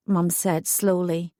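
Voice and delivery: plain reading voice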